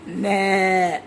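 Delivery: long, low imitated bleat; goat bleat. Shown as a demonstration. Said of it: "बकरी कशी ओरडती".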